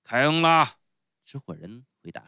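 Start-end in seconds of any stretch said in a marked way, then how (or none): 0.0-0.8 loud, raised ogre voice; booming showman. Acted. Showman grants the clown pardon, booming. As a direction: proclaim the pardon at full volume.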